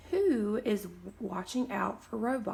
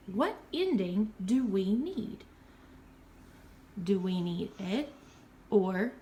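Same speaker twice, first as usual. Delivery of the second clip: ordinary talk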